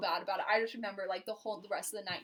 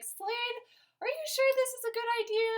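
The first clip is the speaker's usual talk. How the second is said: much higher, anxious doubting question; worried question; 5 dB louder than usual. Retold or imitated. She voices her younger self doubting Slade's plan, high and anxious. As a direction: fret up high as if unsure. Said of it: "and I was like".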